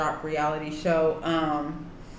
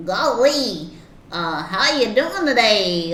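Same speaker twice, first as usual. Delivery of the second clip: high, bright golly cartoon voice; vintage cartoon voice; 4 semitones higher, 6 dB louder, slower than usual. Shown as a demonstration. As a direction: chipper old-time cartoon greeting. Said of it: "a terrible impersonation".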